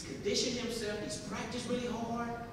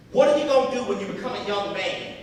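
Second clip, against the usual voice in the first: challenging mother; 2 semitones higher, 12 dB louder, faster than usual. Retold or imitated.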